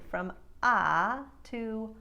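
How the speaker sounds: ah slowly sliding from nasal to narrow; slow glide from the wide ah to a narrow ah; vowel glide. Shown as a demonstration. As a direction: transatlantic vowel shift. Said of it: "bring the corners of the mouth in".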